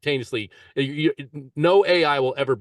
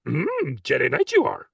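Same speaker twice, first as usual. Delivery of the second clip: slow, croaky little sage; slow Yoda voice; Yoda impression; slower than usual. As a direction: speak backwards like a wise old puppet. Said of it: "So that's Yoda".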